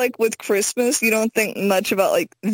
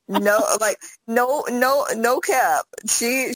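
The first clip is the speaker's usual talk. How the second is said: normal talking voice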